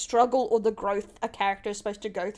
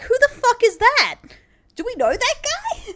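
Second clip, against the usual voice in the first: a loud, much higher disbelieving rant; incredulous outburst; 11 semitones higher, 6 dB louder than usual. Retold or imitated. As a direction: throw it out in mock outrage. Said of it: "And I'm like".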